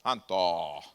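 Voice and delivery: very low, drawn-out macho voice; exaggerated deep tough-guy protest; put-on manly voice. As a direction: drop deep into your chest, mock-offended. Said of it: "hasta pongo mi voz así más".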